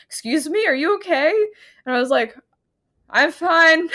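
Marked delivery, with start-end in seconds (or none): 0.0-1.3 concerned lady. Retold or imitated. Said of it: "some lady came in and was like". 3.1-3.9 embarrassed squeak. Retold or imitated. Caught embarrassed in the bathroom.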